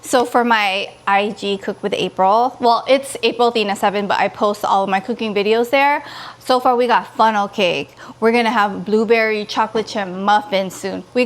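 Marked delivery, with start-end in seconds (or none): none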